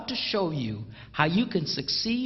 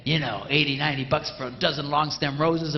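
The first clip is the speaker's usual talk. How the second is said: usual speaking voice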